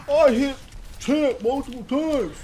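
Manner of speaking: high, loud whiny brag; mock bragger. Mocking the gamer who brags about his rank, high and loud. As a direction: imitate the gamer boasting.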